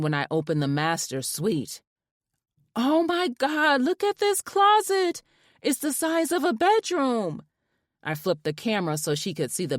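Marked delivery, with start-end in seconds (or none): 2.7-7.4 thrilled. A narrator's reading. Wife showing friend the huge closet, thrilled squeal. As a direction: gush over the closet.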